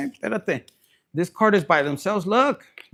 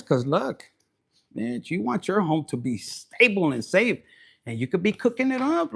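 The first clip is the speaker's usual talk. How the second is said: ordinary talk, nothing marked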